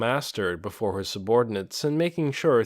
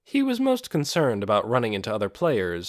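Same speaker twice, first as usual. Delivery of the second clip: ordinary delivery, nothing marked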